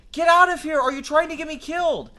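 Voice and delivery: high, loud frantic shouting; panicked yelling. Acted. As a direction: yell at him to stop. Yelling to make him stop.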